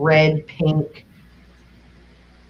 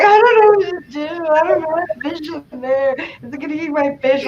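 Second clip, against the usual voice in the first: mock panic; 10 semitones higher than usual. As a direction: act out losing it over the tank. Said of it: "I'm having a conniption".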